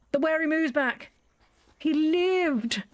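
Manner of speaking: high, joyful relief; light up with relief; delighted relief. Real, not acted. Relieved the chicken is alive.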